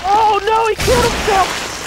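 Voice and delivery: high, startled cry; dismayed exclamation. Real, not acted. Boss kills itself and will respawn.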